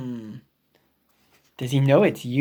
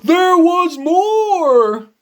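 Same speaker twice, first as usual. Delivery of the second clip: slow, loud, high there was more; dramatic reveal; 7 semitones higher, 4 dB louder, slower than usual. Acted. Gerald builds to his next story, slow and loud. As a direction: draw it out for suspense.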